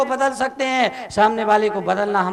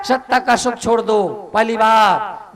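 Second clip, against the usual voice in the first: ordinary talk, nothing marked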